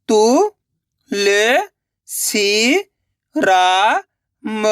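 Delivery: Tulsiram stretched syllable by syllable; very slow sounding out. Shown as a demonstration. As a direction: drag out Tulsiram slowly. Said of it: "पहले तुलसी पढ़ो". (बहुत धीरे खींचकर तुलसीराम)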